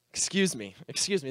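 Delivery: soft, low, just after a sneeze; quiet after sneezing. Real, not acted. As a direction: murmur an apology after sneezing. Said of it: "That's the proper response to that".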